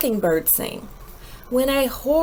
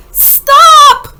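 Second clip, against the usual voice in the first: sharp, very loud high shout; parents yelling; 16 dB louder than usual. Acted. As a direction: yell it to stop a climbing child. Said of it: "Her parents yelled".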